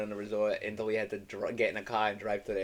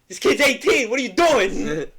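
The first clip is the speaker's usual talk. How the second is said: much higher and louder, half laughing; mock angry uncle; 12 semitones higher, 12 dB louder than usual. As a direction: yell like an outraged uncle. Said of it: "he's like".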